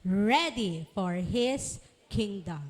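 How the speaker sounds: slow, deliberate recitation of a motto; recited tagline. Shown as a demonstration. Teacher leading children through their tagline, slow and measured. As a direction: lead the class in saying it slowly. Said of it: "One, two, three, go".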